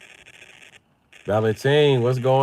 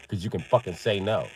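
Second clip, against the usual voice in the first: usual speaking voice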